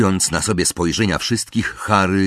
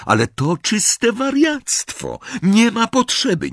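much higher-pitched voice of protest; alarmed protest. A narrator's reading. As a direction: object in a high, agitated voice. Harry protests against the plan. (wysoki, wzburzony głos protestu)

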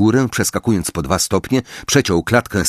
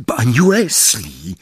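rasped call; 2 semitones higher, slower than usual. Acted. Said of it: "wychrypiał".

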